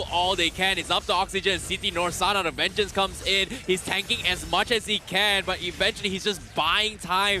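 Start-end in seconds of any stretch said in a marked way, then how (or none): none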